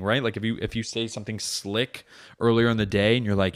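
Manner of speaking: usual speaking voice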